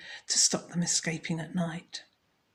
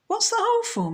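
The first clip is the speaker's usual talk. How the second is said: very high, louder question; curious; 5 dB louder, faster than usual. Acted. Husband eagerly asking the expert about the hole. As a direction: ask eagerly.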